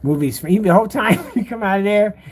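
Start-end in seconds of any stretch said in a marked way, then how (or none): none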